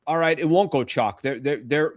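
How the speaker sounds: ordinary talk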